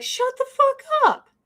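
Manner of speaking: high-pitched, indignant comeback; acted angry retort. Imagined retort to anti-Cuban crowd.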